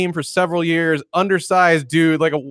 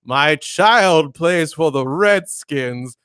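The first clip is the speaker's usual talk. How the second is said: very slow, proper Southern drawl; proper Southern gentleman; much slower than usual. Retold or imitated. Locksmith quoting a customer's dad on the phone, slow Southern voice. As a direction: imitate a dignified Southern dad, drawn out. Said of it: "proper sounding Southern dude voice".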